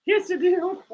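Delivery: high squeaky meerkat puppet; high puppet voice for Alan. Acted. Streamer voicing his meerkat puppet, high and cheeky.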